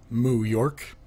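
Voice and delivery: ordinary talk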